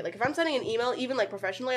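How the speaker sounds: ordinary talk, nothing marked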